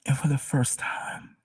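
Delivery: fighting through tears, half whispered; crying. Real, not acted. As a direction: overcome and crying as she testifies. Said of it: "I'm crying".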